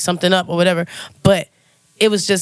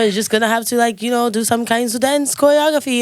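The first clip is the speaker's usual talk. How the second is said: a light, mock French accent, voice a bit higher; 5 semitones higher than usual. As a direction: imitate the French bandleader with a playful French accent. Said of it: "so it's my French accent, y'all".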